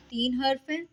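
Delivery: ordinary talk, nothing marked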